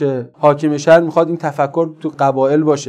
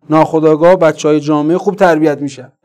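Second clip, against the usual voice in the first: usual speaking voice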